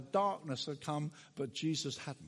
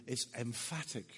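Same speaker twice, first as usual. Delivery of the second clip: ordinary talk, nothing marked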